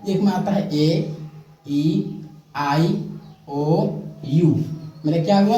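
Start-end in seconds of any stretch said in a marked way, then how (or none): 0.8-4.6 slow spelling out. Shown as a demonstration. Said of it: "तो आप लोग को पढ़ने मालूम नहीं होगा".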